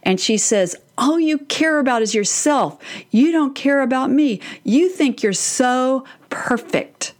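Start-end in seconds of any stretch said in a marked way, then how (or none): 1.0-4.4 put-on angry teen outburst. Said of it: "the volcano blows". 4.6-7.1 slow angry hiss. Retold or imitated. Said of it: "the volcano blows".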